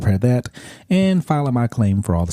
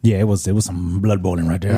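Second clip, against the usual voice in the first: ordinary talk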